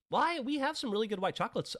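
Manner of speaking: high-pitched mock salesgirl reply; chatty shop girl. Shop girl makes her pitch.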